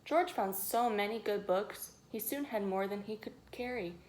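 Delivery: plain reading voice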